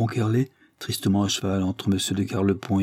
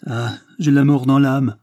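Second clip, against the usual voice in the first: slow, higher, louder anguished outcry; despairing cry; 5 semitones higher, 5 dB louder than usual. A narrator's reading. Henri crying out his despair to Léopoldine, slow and anguished. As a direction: let the despair burst out slowly. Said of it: "s'écria Henri".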